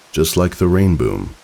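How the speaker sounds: plain reading voice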